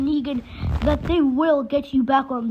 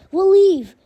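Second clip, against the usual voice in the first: pleading louder and higher on leave; louder desperate repeat; 6 semitones higher, 6 dB louder than usual. A narrator's reading. Glenn begging Negan to spare them, louder plea. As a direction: beg it harder the second time.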